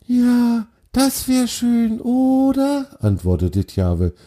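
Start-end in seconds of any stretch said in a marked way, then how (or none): 0.1-2.8 very high, slow little deer voice; a faraway, very high fawn; dreamy little deer. Acted. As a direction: pitch way up, lost in a daydream. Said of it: "ganz in Gedanken versunken".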